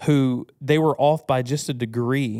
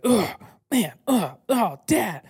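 put-on higher, whiny little boy groaning; frustrated kid; 7 semitones higher, slower than usual.

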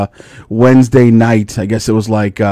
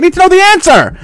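insistent daughter; faster than usual. Retold or imitated. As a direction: demand it. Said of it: "She's like".

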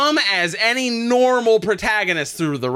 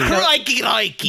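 high-pitched, hammed-up Aussie crikey; TV Australian accent; 7 semitones higher than usual. Parodying TV accents for co-hosts, played-up Australian. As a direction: squawk it like a cartoon Australian. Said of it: "when you hear a T V Australian".

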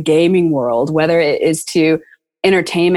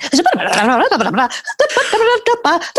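high burst of laughter; 10 semitones higher than usual. Real, not acted. Laughs at own story.

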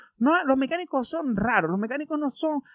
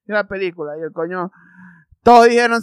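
ordinary delivery

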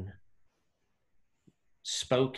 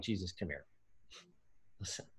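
much softer, pulling someone aside to talk privately; hushed aside; 4 semitones lower, 17 dB quieter, much faster than usual. Retold or imitated. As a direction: drop to a hushed private word. Peter pulls Jesus aside privately.

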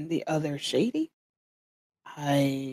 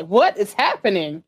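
ordinary talk

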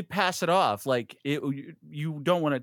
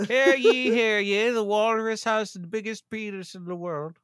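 loud, raised town crier proclamation; 6 semitones higher, 4 dB louder than usual. Pretend. Mock club meeting proclamation.

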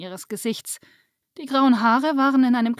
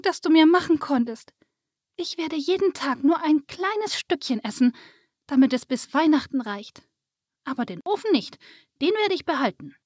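put-on high, grateful child voice; little girl; 6 semitones higher than usual. A narrator's reading. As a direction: thank them as a young girl, pitched up. Narrator voices Lisa thanking fairy. (hohe, dankbare Kinderstimme)

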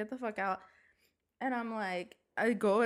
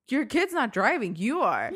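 loud, higher-pitched scolding; retold yelling; 3 semitones higher, 6 dB louder than usual. Driver telling off the other motorist, yelling. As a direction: throw the blame right back at her. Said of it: "after I yelled at her".

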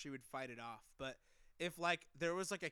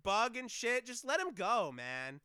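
ordinary talk, nothing marked

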